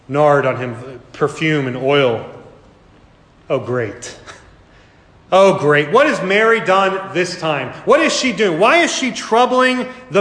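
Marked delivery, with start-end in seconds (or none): 3.5-4.2 quiet, sour "Oh, great."; a low, soft eye-roll on "Oh, great."; muttered sarcasm. Pretend. Disciples annoyed at Mary. 5.3-5.9 "Oh, great." said out loud, exasperated; an exasperated, louder "Oh, great."; louder sarcasm. Pretend. Disciples annoyed at Mary.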